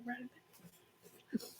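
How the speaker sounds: softly whispered Write it down. Shown as a demonstration. Host showing his guest his hushed reminder, whispered. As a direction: breathe the words as a hushed reminder. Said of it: "Just say".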